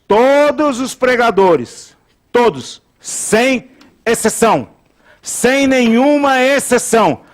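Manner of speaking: high, slow, stretched words; emphatic preaching. Real, not acted. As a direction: declare it absolutely, word by word. Preacher hammers absolute claim.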